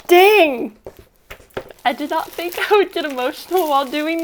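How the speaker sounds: tearful and high, voice breaking; choking up, forcing words through tears; crying. Real, not acted. Unboxing her creator award, she cries in front of viewers. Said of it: "I kind of feel silly for crying".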